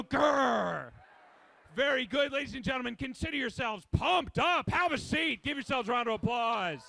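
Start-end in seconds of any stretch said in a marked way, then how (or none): 0.0-0.9 louder shouted tiger growl; mock tiger roar. Shown as a demonstration. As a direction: roar it out at the next person. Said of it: "Turn to somebody else and go".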